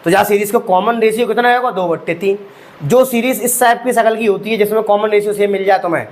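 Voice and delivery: normal talking voice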